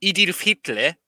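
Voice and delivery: bad South African accent. Shown as a demonstration. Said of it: "that's how you say Adolf Hitler in a South African accent".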